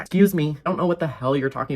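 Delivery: sharp offended excuse me; indignant disbelief. Real, not acted. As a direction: push back, offended. Indignant reaction continues.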